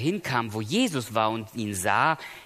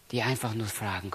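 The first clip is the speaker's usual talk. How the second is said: usual speaking voice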